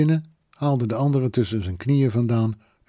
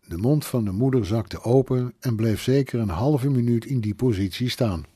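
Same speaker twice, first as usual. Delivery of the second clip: usual speaking voice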